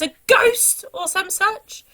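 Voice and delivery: plain reading voice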